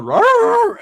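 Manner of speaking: high, loud cartoon dog howl; imitated dog growl. Dog looking into the motel room, loud imitated growl. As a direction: let out a loud mock "rawr". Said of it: "The fucking dog looks in and goes".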